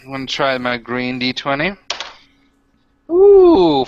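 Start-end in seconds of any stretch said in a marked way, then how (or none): 3.1-3.9 high, loud delighted ooh at the roll; excited ooh. Real, not acted. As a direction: squeal at a good dice roll. Reacting to a dice roll.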